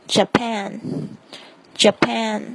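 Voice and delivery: Japan said very slowly with a clear English J; slow J demo; clear J sound. Shown as a demonstration. Teacher modelling the J of Japan for learners, slow. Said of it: "注意这个J的发音".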